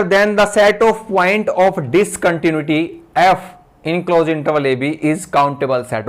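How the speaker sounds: very slow, word by word, for note-taking; slow dictating teacher; slow dictation. Shown as a demonstration. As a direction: read it out slowly while students write. Dictating theorem for notes.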